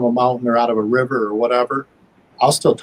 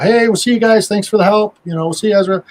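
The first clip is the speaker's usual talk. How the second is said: higher, mock calling-out to a departing group; retold shout; 7 semitones higher than usual. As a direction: call out a friendly goodbye, half-shouted. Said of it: "you kinda shouted out".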